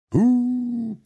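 high loud whoop as the stone rolls off; old man's triumphant whoop; whooping cry. Acted. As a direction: let out a big high whoop. Boulder rolls away, exclaimed.

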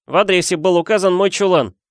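high, insistent protest; Harry, angry. A narrator's reading. Boy disputing uncle's excuse, high and cross. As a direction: press the point crossly. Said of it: "сердито сказал Гарри".

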